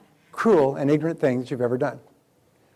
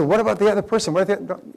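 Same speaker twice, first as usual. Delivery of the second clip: objecting listener; 6 semitones higher, 5 dB louder than usual. Retold or imitated. Voicing audience objection.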